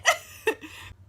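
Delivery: breathy high giggle; high laugh. Real, not acted. After Kat takes her shoes off, a high giggle. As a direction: giggle out loud.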